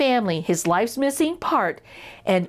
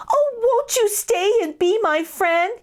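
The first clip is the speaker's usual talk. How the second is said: much higher pleading mouse, near tears; tearful plea; 11 semitones higher than usual. A narrator's reading. Elvin begging Braden to stay, high and tearful. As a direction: beg him to stay.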